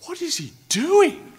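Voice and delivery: stunned by someone's foolishness; incredulous. Retold or imitated. Onlookers watching the fool whack a lion, incredulous cry. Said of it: "everybody else thinking".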